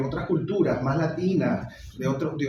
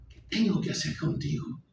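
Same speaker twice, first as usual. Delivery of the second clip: anger choked down, voice dropping; exasperated question, reined in after shouting; irate; 3 semitones higher, 4 dB quieter than usual. Acted. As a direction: seethe helplessly at your father, held back. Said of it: "él responde iracundo".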